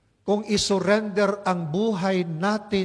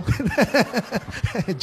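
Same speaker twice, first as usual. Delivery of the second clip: laughing at own joke; 4 semitones higher than usual. Real, not acted.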